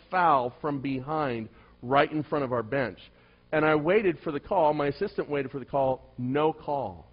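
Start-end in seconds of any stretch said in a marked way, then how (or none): none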